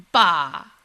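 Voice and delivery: loud, low sulky grunt. Acted. Husband denied his song, sulky bah. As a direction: huff it out like a disappointed child. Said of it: "sulked Friday".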